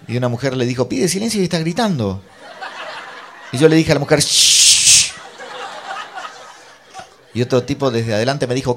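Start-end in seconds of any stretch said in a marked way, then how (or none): 0.9-2.2 high-pitched mock scolding; indignant reproach. Mujer reprocha en la riña. 4.2-5.1 long, loud shush; drawn-out hiss to silence someone; imitated shushing. El narrador chista a la mujer.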